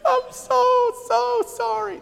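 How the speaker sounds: very high, hammed-up fake crying whine; badly faked tearful apology, way up high; fake sorry whine. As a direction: squeak it out like a kid faking remorse. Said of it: "really show them how sad I am".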